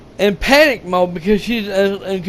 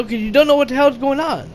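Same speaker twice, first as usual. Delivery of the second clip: usual speaking voice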